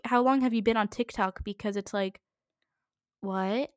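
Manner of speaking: normal talking voice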